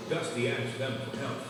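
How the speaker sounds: usual speaking voice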